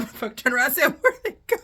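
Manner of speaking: loud, high, rushed words through laughter; cracking up. Real, not acted. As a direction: burst out laughing as you tell it. Cracks up retelling the escape.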